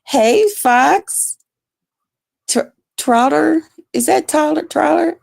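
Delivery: high, bright hello to a viewer; high greeting voice. Real, not acted.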